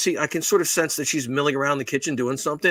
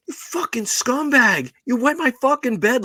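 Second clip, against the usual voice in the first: imitated outrage; 9 semitones higher than usual. Man imitating a woman yelling at him for wetting her bed. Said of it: "that's the normal reaction".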